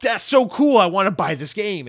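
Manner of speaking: put-on squealing high and loud with childlike glee; excited kid. Kid telling himself about the new game, gushing high and loud. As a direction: gush like a thrilled nine-year-old.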